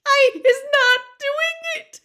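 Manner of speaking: slow, piercingly high, loud squeal; Winky. A narrator's reading. Terrified house-elf denies it to the wizard, squealing. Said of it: "she squealed".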